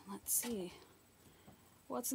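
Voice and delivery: soft whisper while peeking. Real, not acted. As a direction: whisper so nobody hears. People in the house, sneaking.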